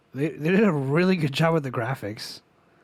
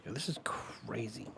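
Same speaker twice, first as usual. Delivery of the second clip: whispering; 11 dB quieter than usual. Real, not acted. Awed whisper, unvoiced.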